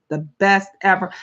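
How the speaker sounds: ordinary talk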